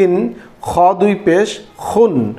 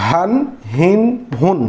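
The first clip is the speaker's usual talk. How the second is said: usual speaking voice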